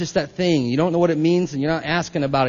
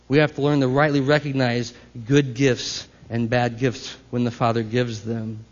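usual speaking voice

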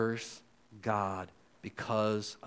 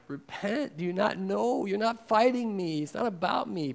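higher and louder earnest appeal; urgent plea; 10 semitones higher, 5 dB louder than usual. Acted. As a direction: plead with the person you argue with. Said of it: "You should be like".

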